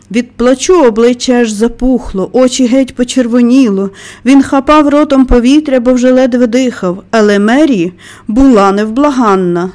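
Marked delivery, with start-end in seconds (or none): none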